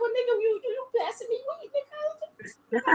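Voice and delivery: high sassy disbelief; hammed-up retort, sky-high pitch; incredulous. Her own retort, mocking.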